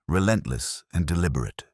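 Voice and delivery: usual speaking voice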